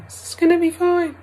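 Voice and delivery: very high, small and soft; tiny voice. Retold or imitated. Tiny inner voice reassuring her, very high and small. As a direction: pipe it like a tiny inner helper. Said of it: "little tiny voice going".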